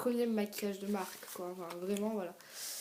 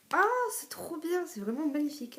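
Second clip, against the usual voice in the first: high-pitched gushing admiration; real thrilled reaction; delighted; 7 semitones higher, 3 dB louder than usual. Real, not acted. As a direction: gush in a high voice.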